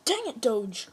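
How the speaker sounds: loud annoyed shout at the dog; frustrated yell. Real, not acted. Dog companion causing trouble.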